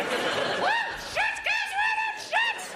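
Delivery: put-on very high, shouted drunken squeal; shrieking drunk girls. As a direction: shriek it like a wasted partygoer at the races. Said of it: "They slowly deform".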